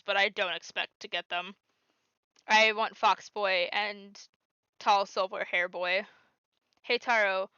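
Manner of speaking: ordinary delivery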